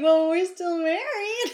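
laughing. Acted. Wry quote about their marriage.

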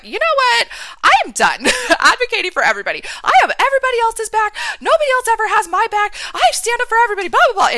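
shouted high and loud, fast; outburst. Retold or imitated. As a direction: let it all out in a fast, loud rant. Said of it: "I just let that wall down and I just went hard".

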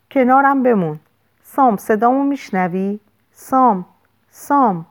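desperate pleading. A narrator's reading. Lou turning Sam's face to her, desperately pleading. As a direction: beg him to stay, calling his name. Begging dying patient to stay.

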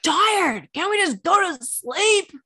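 high, loud, whiny protest; complaining kid yell. Retold or imitated. Tired son protests prayers from bed, whiny yelling. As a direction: holler in disbelief from under the covers. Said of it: "so we had to pray loud".

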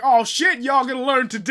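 high, loud hollering at the screen; excited crowd heckler. Acted. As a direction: holler like a hyped-up moviegoer. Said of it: "somebody in the crowd's like".

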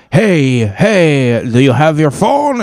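high, calling-out mock French accent. French guard stopping rider for a phone check, high mock French accent. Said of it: "this guy had a French accent".

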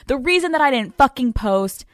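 ordinary talk

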